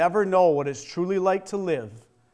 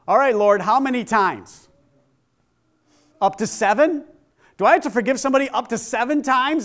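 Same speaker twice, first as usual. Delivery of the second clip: louder, higher, challenging questions; Peter's questioning; 7 semitones higher, 9 dB louder than usual. Acted. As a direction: push back in disbelief, raising the voice. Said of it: "what was his answer?".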